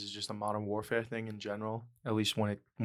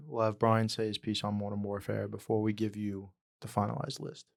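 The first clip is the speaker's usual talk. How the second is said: usual speaking voice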